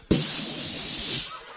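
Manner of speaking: unvoiced whale blowhole spray; whale spray hiss. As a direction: spit air like a whale spout.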